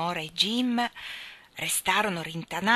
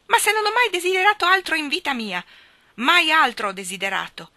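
much higher, louder exasperated confession; exasperated outburst; 9 semitones higher, 8 dB louder than usual. Acted. Jim blurts his lifelong wish to Nora, exasperated. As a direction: blurt it out, beside himself. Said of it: "esclamò Jim esasperato".

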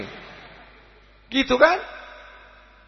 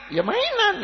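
lightly voiced child, high-pitched; childish reply; 14 semitones higher, 3 dB quieter, slower than usual. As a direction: answer in a high little kid's voice. Child answers parent's question.

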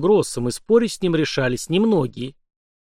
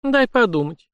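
higher-pitched musing; pensive; 8 semitones higher than usual. A narrator's reading. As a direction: make a show of thinking it over. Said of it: "задумалась на секунду жена". (задумчиво, высоким голосом)

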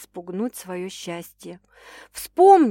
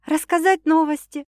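high, lively afterthought; upbeat woman's voice; cheerful; 7 semitones higher, 4 dB louder than usual. A narrator's reading. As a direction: add it merrily, pitch up. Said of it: "весело хмыкнула девушка".

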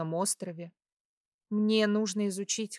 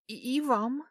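flustered, higher stammered reply; nervous gulp; 5 semitones higher than usual. A narrator's reading. Heroine hiding how his closeness stirs her, nervously faltering. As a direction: stumble on the first word, pitch lifting. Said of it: "сглотнув слюну".

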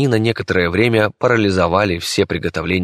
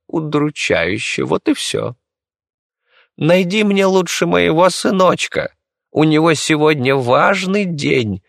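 condescending; 9 semitones higher, slower than usual. Acted. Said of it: "с долей снисхождения пояснила".

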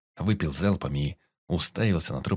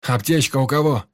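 high, loud call for help; urgent shout; 6 semitones higher, 7 dB louder than usual. A narrator's reading. Andrey running to the wounded man, shouting urgently. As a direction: call out for the kit fast. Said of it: "крикнул Андрей".

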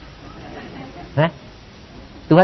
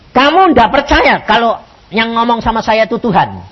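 higher, louder indignant challenge; angry prophet; 7 semitones higher, 4 dB louder than usual. Retold or imitated. Prophet challenging the preacher's belief in God's word, angrily. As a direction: act out the offended prophet confronting you. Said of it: "Nabi ini marah".

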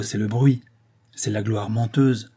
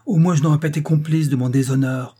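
loud, higher-pitched exclamation; crying out; 5 semitones higher, 5 dB louder than usual. A narrator's reading. Husband protesting his dishonour, crying out. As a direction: cry it out in indignation. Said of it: "s'écria-t-il".

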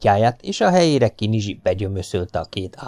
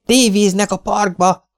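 put-on high, loud boy's yell; excited yell; 12 semitones higher, 5 dB louder than usual. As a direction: burst in shouting the big news. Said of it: "üvöltötte Domi". (magas, hangos, izgatott gyerekkiáltás)

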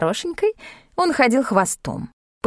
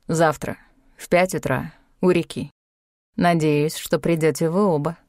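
lower, calm, measured elder; Tibetan sage; 4 semitones lower than usual. A narrator's reading. Tibetan guide admonishes Tanya, calm and grave.

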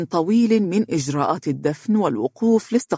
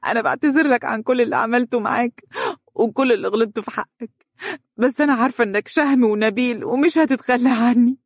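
much higher, sobbing desperate begging; tearful pleading; 8 semitones higher, faster than usual. A narrator's reading. Grieving wife begging husband not to leave, sobbing. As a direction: beg him through the tears. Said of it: "وأخذت تبكي وتشهق بقوة وهي ترجوه".

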